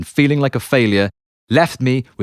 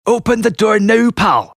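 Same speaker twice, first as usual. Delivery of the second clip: a raised, higher-pitched demand through the door; demanding guard; 8 semitones higher than usual. Retold or imitated. Guard hammering on the door, demanding it open. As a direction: order him to open up, menacing.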